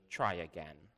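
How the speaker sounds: low, quiet sternness; stern. A narrator's reading. Father warning his third daughter, quite stern. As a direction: say it low and firm. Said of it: "and then quite sternly".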